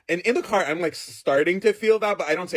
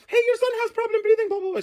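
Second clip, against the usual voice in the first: very high-pitched nurse impression; put-on high Russian nurse voice. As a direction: squeal it like a fussing nurse. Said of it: "I had a Russian nurse".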